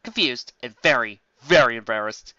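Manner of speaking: usual speaking voice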